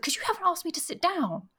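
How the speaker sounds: very high mock-indignant retort; mock indignation. Host retelling the guest's retort to the heroine, mock indignation. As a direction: imitate his protest, pitched right up. Acted quote of his retort.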